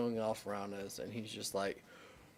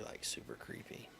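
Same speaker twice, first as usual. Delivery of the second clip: creepy whisper; 11 semitones lower, 13 dB quieter than usual. Pretend. Creepy Dahmer glasses whisper.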